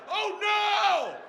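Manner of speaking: high, loud drawn-out cry; mock horrified scream. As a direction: scream it like a dad witnessing horror. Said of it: "then I started screaming".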